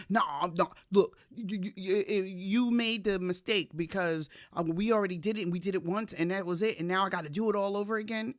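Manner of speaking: defensive justifying. Retold or imitated. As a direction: argue your way out of the blame quickly. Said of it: "immediately I wanted to justify it".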